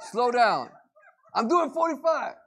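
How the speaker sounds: mock snapping husband. Husband snaps at wife about his speed, mock irritated. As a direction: snap back at the wife in traffic.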